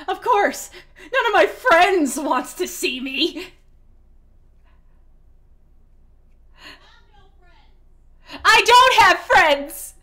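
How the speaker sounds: distant yelling through a wall; shouted from the next room; [name] from another room. Real, not acted. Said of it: "that's [name] from the other room".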